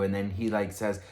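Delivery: ordinary delivery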